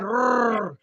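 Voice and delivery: "Rrr" with a trilled, rolled r. Retold or imitated. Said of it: "She said".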